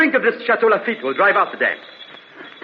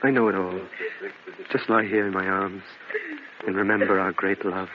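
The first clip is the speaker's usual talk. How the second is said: tender soothing; 10 semitones lower, 8 dB quieter than usual. Acted. Lover soothing dying Lucia.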